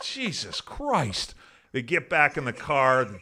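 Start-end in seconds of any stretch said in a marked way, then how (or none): 0.0-1.4 slow, high, drawn-out disbelief; exasperated. Retold or imitated. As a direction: stretch it out in half-laughing dismay. Driver watching girl pee.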